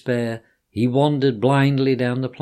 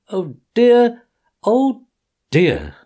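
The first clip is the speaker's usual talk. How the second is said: tearful oh dear; 10 semitones higher, 7 dB louder, slower than usual. Acted. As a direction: cry it, letting the last dear drop. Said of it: "crying afresh".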